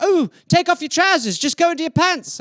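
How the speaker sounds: high mock English parent; high put-on parent voice about trousers. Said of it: "my parents would be like".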